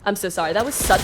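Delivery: ordinary delivery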